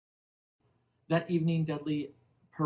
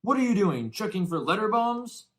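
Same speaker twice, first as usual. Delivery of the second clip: high, loud jeering joke; loud high teasing uncle; mocking uncle; 10 semitones higher, 9 dB louder than usual. A narrator's reading. Uncle Vernon joking.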